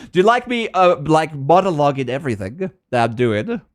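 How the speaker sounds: usual speaking voice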